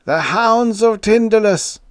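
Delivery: very high, loud scream; frenzied cry of horror; shrieking. A narrator's reading. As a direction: shriek the dreaded name. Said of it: "he shrieked".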